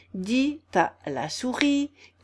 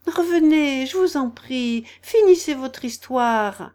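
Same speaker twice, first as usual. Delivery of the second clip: high, loud pleading call; raised storybook cry, entreating; 5 semitones higher, 7 dB louder than usual. A narrator's reading. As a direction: call someone back urgently. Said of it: "lui cria Alice".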